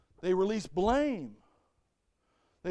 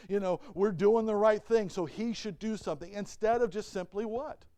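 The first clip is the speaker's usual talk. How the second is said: ordinary talk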